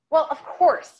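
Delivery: loud, high exasperated laugh-edged reply; exasperated chuckle. A narrator's reading. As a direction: huff it out with a chuckle. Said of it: "with an exasperated chuckle".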